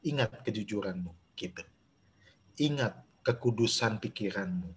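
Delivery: hushed reminding voice of conscience; soft inner voice. Acted.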